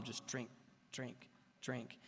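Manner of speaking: soft, hypnotic repeated drink; droning inner voice. Retold or imitated. Craving's inner voice chanting drink, quietly droning. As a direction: let drink drone like a drumbeat. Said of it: "constantly droning, like this drum".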